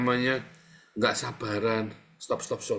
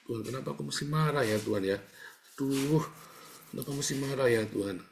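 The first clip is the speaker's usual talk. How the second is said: rushed lament; 4 semitones higher, faster than usual. Acted. Acted fretful confession prayer.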